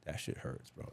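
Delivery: real pained whisper; hushed whisper. Real, not acted. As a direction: drop to a breathy whisper as it stings. Painful admission about his son.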